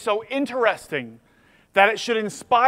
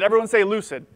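ordinary talk

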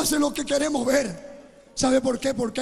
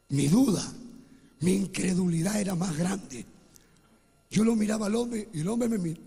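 ordinary talk, nothing marked

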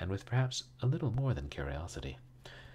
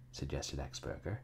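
ordinary delivery, nothing marked

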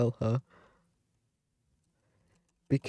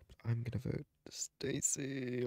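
hushed; 2 semitones higher, 10 dB quieter than usual. Real, not acted. Confides vote choice.

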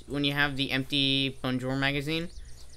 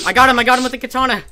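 fast, loud, high victory yell; triumphant gamer shout; excited shout; 10 semitones higher, 11 dB louder, much faster than usual. Real, not acted. Kills enemy after ambush.

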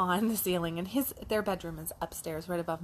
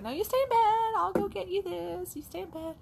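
put-on singsong mom pinning a kid in bed; high insistent mom; 12 semitones higher, 5 dB louder than usual. Mom tells her early-rising son to stay put, high and firm.

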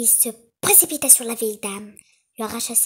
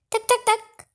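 much higher, louder put-on old lady; the wolf plays granny; wolf faking grandmother; 11 semitones higher, 7 dB louder than usual. A narrator's reading. Wolf in bed pretends to be the grandmother, high and loud. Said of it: "en imitant la voix de la vieille dame".

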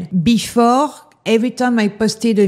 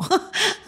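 short laugh; 11 semitones higher, 7 dB quieter than usual. Real, not acted. Bitter laugh about haters.

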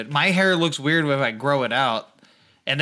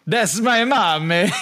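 high, slow words breaking into real laughter; laughing; 7 semitones higher, 4 dB louder, slower than usual. Real, not acted. Laughing as his mom interrupts the podcast. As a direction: laugh it out, caught off guard.

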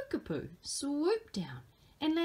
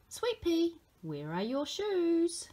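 higher, slow motherly question; Mama asking; 7 semitones higher, slower than usual. Acted. Mama asking her child about shoes, higher and slow. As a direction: ask gently, drawn out like Mama.

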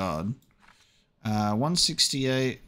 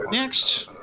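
loud, high call of next; 13 semitones higher, 4 dB louder than usual. Real, not acted. Checkpoint guard calling the next traveller forward, loud. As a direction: summon the next one in line.

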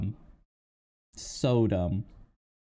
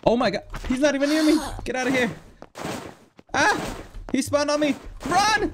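high frantic yelps, much louder; panicked yelling; 5 dB louder than usual. Real, not acted. Gamer fleeing an enemy, panicked yells. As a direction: shriek in panic as he runs.

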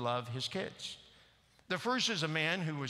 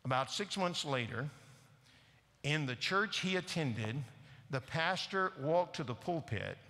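ordinary talk, nothing marked

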